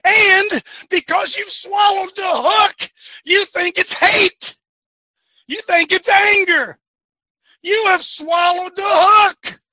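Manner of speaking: loud, high, driving sermon voice; fiery preaching. Acted. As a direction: hammer each point home from the pulpit. Preaching at full force.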